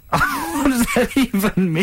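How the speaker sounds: high, slow, helpless laughing. Real, not acted. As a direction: squeeze the question out through laughter. Cracking up at silly joke.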